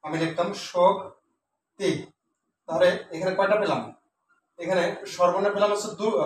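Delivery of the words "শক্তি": শক্তি drawn apart with a pause in the middle; শক্তি as two isolated syllables; split into syllables. Shown as a demonstration. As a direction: slowly dictate শক্তি one syllable at a time. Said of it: "শোক হচ্ছে কি একসাথে".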